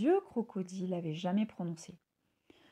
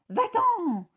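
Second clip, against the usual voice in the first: loud snap; a hint of grumpy crocodile; 9 semitones higher, 8 dB louder than usual. A narrator's reading. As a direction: shoo him off gruffly. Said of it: "fit le crocodile".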